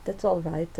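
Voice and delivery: usual speaking voice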